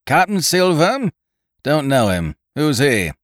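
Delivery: high, loud incredulous query; captain's loud, dismissive challenge; cried retort. A narrator's reading. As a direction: fling back a scornful question. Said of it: "cried the captain".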